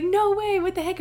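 stunned, delighted disbelief; shocked disbelief. Retold or imitated. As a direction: react like you can't believe it. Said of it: "And I was like".

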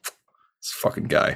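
whispered exasperation; exasperated whisper. Retold or imitated. Mom's silent this-guy look put into words. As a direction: breathe it with an eye-roll. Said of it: "a look that you often give".

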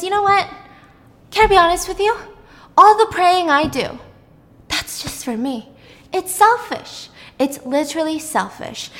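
high-pitched earnest plea; desperate confession. Acted. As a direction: blurt out the truth in a rush of desperation. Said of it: "she's feeling desperate".

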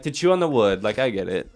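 normal talking voice

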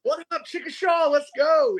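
hammed-up frontman hello; mock stage shout. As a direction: play a rock singer greeting the town. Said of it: "I made that mistake".

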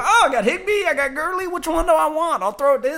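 very high, mock-gleeful player; put-on high voice, hammed-up delight; giddy quarterback impression. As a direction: mimic a quarterback spoiled for choice. Mimics quarterback choosing between open receivers.

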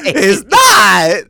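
high, shouted denial; loud protest. Real, not acted. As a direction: yell back over the laughter. Loud protest at being teased.

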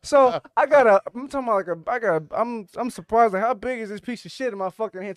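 loud and high, disgusted; shocked outburst. Real, not acted. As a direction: yell it out in disbelief.

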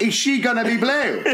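high, comically dramatic question; mock interrogation. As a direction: push the absurd warning, near laughter. Mock-warning a child, song lyrics.

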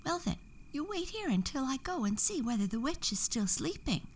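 lightly voiced hummingbird offering help. A narrator's reading. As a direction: chirp it out quickly like a small bird. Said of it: "said one hummingbird".

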